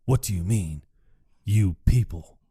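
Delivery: low, gruff put-on voice, lightly done; Kirk Lazarus voice. Retelling the movie, Kirk questions "you people" in a low put-on voice. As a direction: drop the voice low for the fake tough guy.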